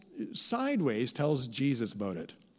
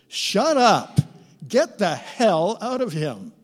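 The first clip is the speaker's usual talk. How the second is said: high, loud shout of shut up; furious command; 5 semitones higher, 7 dB louder, slower than usual. Acted. Jesus ordering the evil spirit out, shouting. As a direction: bark the spirit out. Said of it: "what Jesus is really saying".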